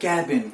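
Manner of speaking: "cabin" as KAB-in, short open A; correct pronunciation. Shown as a demonstration. Teacher leading learners to repeat, cabin as KAB-in. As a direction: model cabin with a falling tune. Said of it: "एक बार फिर से".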